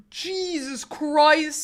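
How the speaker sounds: very slow, drawn-out exclamation; mock shock. Streamer reacting to Sarah, slow drawn-out shock. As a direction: stretch it out in disbelief.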